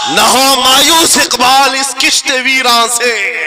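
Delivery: high, loud poetry recital to a crowd; ringing Urdu verse; declaimed verse. Real, not acted. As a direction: proclaim the couplet like a rally orator.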